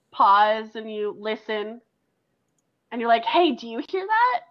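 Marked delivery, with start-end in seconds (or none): 3.2-4.4 raised pitch, rising question; high-pitched, puzzled query; uneasy questioning. Acted. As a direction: check if the others hear it too. Acted question about a strange sound.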